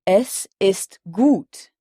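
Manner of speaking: "gut" said slowly as goot, U as ou, with a final T; slow German model. Shown as a demonstration. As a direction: stretch "Es ist gut", the U of gut an ou sound. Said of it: "Le U allemand se prononce toujours ou".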